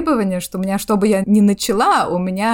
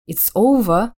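British OVER ending in a vowel, no R; British pronunciation; 2 semitones higher, 4 dB louder, slower than usual. Shown as a demonstration. Explaining the silent R on her accent course, British accent. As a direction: say OVER the British way, dropping the final R. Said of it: "произносится только если после неё идёт гласная".

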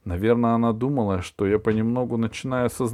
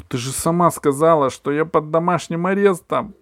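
louder, higher near-yell; almost shouting; 8 semitones higher, 6 dB louder than usual. A narrator's reading. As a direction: nearly shout in protest. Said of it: "чуть ли не закричал я".

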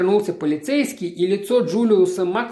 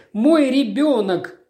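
higher and slower, a mother's cry; anguished cry; 6 semitones higher, slower than usual. A narrator's reading. Natalie cries out to her husband about her dead child, anguished and slow. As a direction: draw it out in grief. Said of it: "крикнула она".

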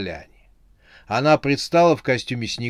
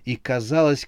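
ordinary delivery, nothing marked

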